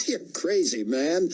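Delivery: mocking soldier. A narrator's reading. Soldiers mocking their mate.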